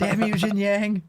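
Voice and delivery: slow, high-pitched sitcom cry; drawn-out, high mock lament; mock cursing. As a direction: curse a rival in hammed-up frustration. Quoting a sitcom scene mockingly.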